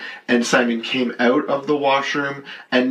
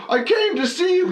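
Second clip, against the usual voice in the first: high-pitched, innocent heckler reply, imitated; mock eager fan in a high voice; Simon. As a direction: call back cheerfully to the stage. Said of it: "He's like".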